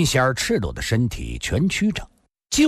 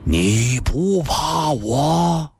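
menacing question; 6 semitones higher, much slower than usual. Acted. Yiku asks Lin Xian'er, slow and menacing. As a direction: ask it heavily.

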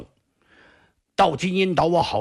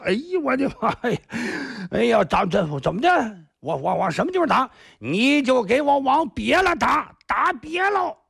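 panicky cry; 8 semitones higher than usual. Acted. Frightened boy cries out.

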